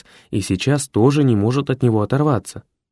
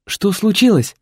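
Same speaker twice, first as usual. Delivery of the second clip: Boris, much higher and alarmed; secretary voice; 10 semitones higher, 4 dB louder than usual. A narrator's reading. Secretary runs in asking what happened. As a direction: rush in asking in a high voice. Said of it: "спросил секретарь".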